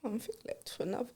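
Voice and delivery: barely audible whisper; a nervous unvoiced whisper. Real, not acted. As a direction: breathe it out so he can hardly hear. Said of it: "wait, I didn't hear that".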